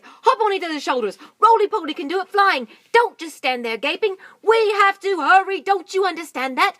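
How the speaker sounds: high, loud frantic orders; urgent monkey shouting. Acted. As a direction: hurry them all up, yelling. Mugglewump urging animals.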